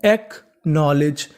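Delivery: "Acknowledge" slowly split after the first syllable; slow, divided teaching take of "acknowledge"; broken into parts. Shown as a demonstration. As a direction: sound out "acknowledge" with a gap inside it. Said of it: "तो पूरी meaning बनी".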